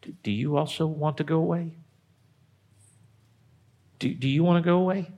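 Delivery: low, soft and sad, almost a whisper; Jesus asking his twelve, hushed and sad; low near-whisper. Retold or imitated. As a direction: ask it gently in a hushed sad voice. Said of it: "maybe in a low voice ... in kind of a whisper".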